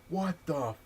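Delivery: high-pitched mock outrage; retold flipping out. Beaten kid flips out on the mic, mock outraged. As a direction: imitate a kid losing his temper. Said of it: "he flips out on the mic".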